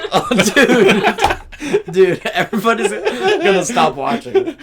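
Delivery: high, loud helpless laughter; words spilling out through laughter; laughing hard. Real, not acted. Cracking up at burping friend.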